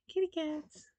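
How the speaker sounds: kitty cats said high, in a put-on lilt; light high kitty cats. Shown as a demonstration. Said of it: "testing the voice twice".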